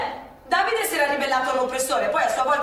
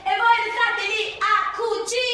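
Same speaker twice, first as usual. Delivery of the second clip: higher, slow cheeky jeer; mocking taunt; 9 semitones higher, 4 dB louder, slower than usual. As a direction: taunt the sewing girls. Child taunting the classmates.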